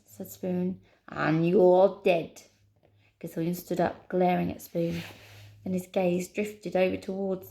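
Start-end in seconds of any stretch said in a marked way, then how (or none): 1.1-2.4 loud, slow Scottish threat; Scottish accent. Acted. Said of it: "a Scottish accent".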